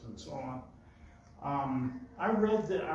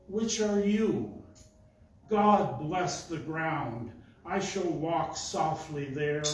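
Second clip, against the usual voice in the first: slow, measured poetry reading; poem recitation; 2 semitones higher, 5 dB louder, slower than usual. Acted. Poet reading Roethke to the audience, measured recitation. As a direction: recite it line by line with deliberate pauses and firm stresses.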